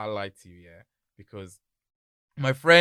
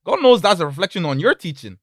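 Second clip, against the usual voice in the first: louder, climbing angry parent; confronting parent; 6 semitones higher, 7 dB louder than usual. Pretend. Parent confronting son's teacher, building louder and higher. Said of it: "I'm like".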